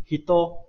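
hido with t unaspirated, close to d. Shown as a demonstration. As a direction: say the d in hido without a puff of air. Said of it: "这个假名哈是不送气的".

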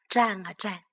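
usual speaking voice

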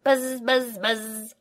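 playful high bee buzz; lightly voiced little bee noises. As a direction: buzz it like a child pretending to fly. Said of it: "Let's pretend to be bees".

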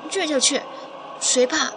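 put-on defiant childish retort. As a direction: take the dare like a stung kid, punching the last words. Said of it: "我当然不服气了".

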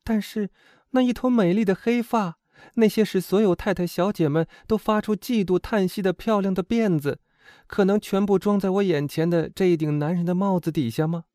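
put-on high young woman's voice, wondering; wondering girl companion, high voice; admiring, doubtful friend. A narrator's reading.

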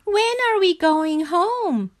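high, whining frog question; high-pitched plaintive moan. A narrator's reading. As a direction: whine to go home in a high voice. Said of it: "he moaned".

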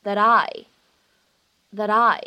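"that I" 连读，t 发成闪音; linked with a flap. Shown as a demonstration. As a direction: glide "that I" as one word with a flapped t. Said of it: "连读我们可以读成是that I".

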